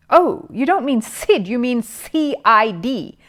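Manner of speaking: slow, spelling out each letter; slow clarifying. A narrator's reading. Desk man explaining CID slowly to Paddington. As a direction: make the letters plain to a bear. Policeman clearing up misunderstanding.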